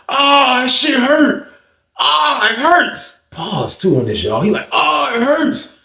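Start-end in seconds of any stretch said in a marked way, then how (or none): 0.1-3.2 high-pitched mock moaning; drawn-out high wail, hammed up; imitated pain cries. 4.7-5.8 slow, high-pitched mock moan; stretched-out high cry, hammed up; imitated pain cry.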